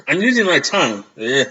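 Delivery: sipping through a straw; slurred around a mouth guard. Real, not acted. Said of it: "hard to do it without the tongue".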